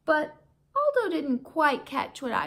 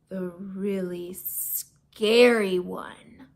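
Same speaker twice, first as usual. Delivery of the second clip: slow, low, ominous voice; spooky; 5 semitones lower, much slower than usual. Acted. Reading to kids, warning of the scary teacher, slow and low. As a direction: draw it out like a ghost story.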